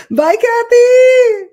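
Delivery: very high, loud, drawn-out farewell; calling out. Real, not acted. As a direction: sing it out long and high.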